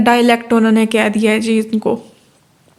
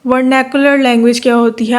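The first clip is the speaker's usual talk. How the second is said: ordinary talk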